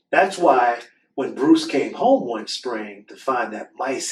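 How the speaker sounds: lively storybook read-aloud; reading to children. Acted. Host reading a book to children, animated storytelling. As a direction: read the picture book to the kids with expression.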